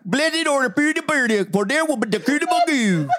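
rising and falling gibberish recitation; gibberish Bible reading. Pretend. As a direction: recite scripture as rubbery nonsense syllables. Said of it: "they should have a Bible version of this".

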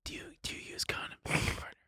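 hushed whisper. Real, not acted. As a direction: whisper it, embarrassed. Whispered confession about hygiene.

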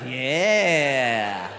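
long drawn-out low yeah; basking in applause. Retold or imitated. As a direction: stretch it out, soaking up the crowd. Comedian basking in crowd's support.

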